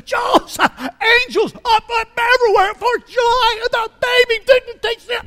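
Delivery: overjoyed shepherds. Acted. Shepherds bursting in on Joseph, ecstatic high shout. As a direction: burst in breathless with the news.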